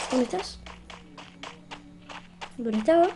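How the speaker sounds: ordinary talk, nothing marked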